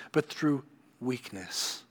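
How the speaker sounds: slow, deliberate stress on "through weakness"; slow emphasis. Acted. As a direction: lean on "through weakness" slowly. Preacher stressing key phrase.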